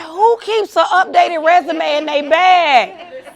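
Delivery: loud, high, drawn-out mock disbelief; incredulous exclamation. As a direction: cry it out slowly in amused astonishment. Retold astonished reaction, audience laughs.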